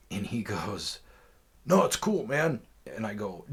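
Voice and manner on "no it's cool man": "No, it's cool, man" higher and louder, breezy; imitating the seller. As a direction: toss off "No, it's cool, man" like a carefree dude. Seller's reply voiced in story.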